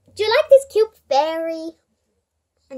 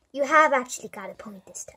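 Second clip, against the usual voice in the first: whispered aside; whispering; 6 semitones lower, 6 dB quieter than usual. Pretend. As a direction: whisper it to yourself. Aside to herself.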